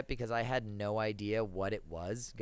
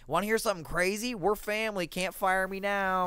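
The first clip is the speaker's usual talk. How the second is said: gleeful; 9 semitones higher, 5 dB louder than usual. Retold or imitated. Gleeful announcement at practice.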